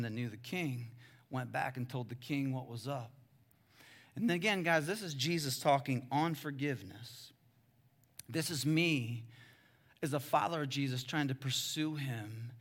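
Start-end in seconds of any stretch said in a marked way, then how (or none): none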